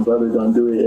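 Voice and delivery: normal talking voice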